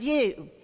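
"you" given a firm push; stress on you. Shown as a demonstration. Teacher showing the class how to stress "you". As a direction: say "you" with weight, as the model for the group. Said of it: "I want you to emphasize that word".

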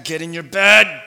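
stern dad. Retold or imitated. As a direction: snap the kid back to bed. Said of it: "I know exactly what Eli's going through".

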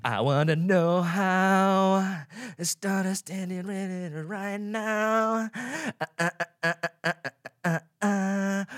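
slow, high put-on voice; slow, high mock voice. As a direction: drag it out in a lightly voiced character. Sudden odd voice bit.

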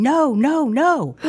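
urgent high shouted no no no; frantic no. Retold or imitated. Station worker yelling at a passenger to stop. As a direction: warn her off the train in a hurry. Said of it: "the guy is going".

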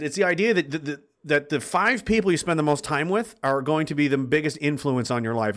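usual speaking voice

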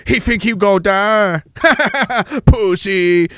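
put-on slow, jeering caricatured Chinese accent; put-on Chinese accent with a mocking cackle; mock Chinese cop. Chinese cops laugh at their victim, mock Chinese accent.

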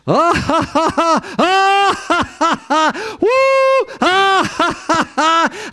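very high whooping, forced belly laughs with a taunt; demonstrated laughing. Shown as a demonstration. Preacher shows how to laugh at the devil, wild high mocking laughter. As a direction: laugh defiantly at full tilt, whooping between bursts. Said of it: "I just start laughing just like this".

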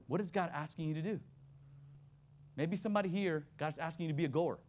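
ordinary delivery

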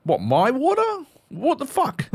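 high, disbelieving outrage; mock-indignant at a stranger; squeaky high indignation; incredulous what. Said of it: "I'm like".

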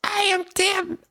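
squeaky high intro voice; high put-on voice. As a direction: say your name way up high. Mock formal self-introduction.

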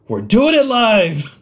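high, slow, hammed-up holler; mock outburst. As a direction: belt it out high in mock frenzy. Mock live-animation outburst.

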